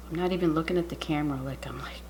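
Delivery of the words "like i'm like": whisper. Real, not acted.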